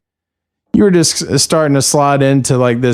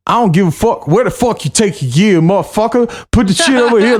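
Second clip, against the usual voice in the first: cuss him out, climbing higher and higher; aggressive cussing out; 7 semitones higher than usual. Retold or imitated. Club worker cussing out the band, rising and hammed up. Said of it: "aggressively cussing me out".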